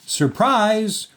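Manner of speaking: high, short party cry; spring the surprise with a high shout; excited shout. A narrator's reading. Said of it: "shouted Amelia Bedelia".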